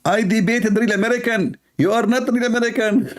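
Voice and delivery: high mocking voice of the imam; mocking imitation. Mocking the Philippine imam's reply, high and sneering. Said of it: "He said".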